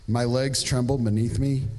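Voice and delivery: normal talking voice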